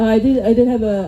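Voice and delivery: usual speaking voice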